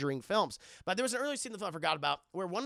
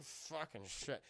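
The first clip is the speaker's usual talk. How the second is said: low, much softer, slow cursing; muttered curse; 6 semitones lower, 13 dB quieter, slower than usual. Retold or imitated. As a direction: curse under the breath. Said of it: "the mom's like".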